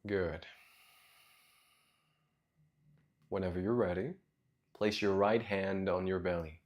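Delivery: soft, low meditation voice with long pauses; guided meditation. Real, not acted. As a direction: guide them gently into their breath, quietly and low.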